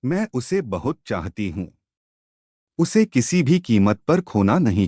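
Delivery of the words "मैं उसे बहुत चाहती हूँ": ordinary delivery, nothing marked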